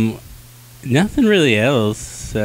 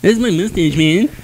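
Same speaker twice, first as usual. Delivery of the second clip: mock dude voice; 8 semitones higher than usual. Mock dude defending mustache.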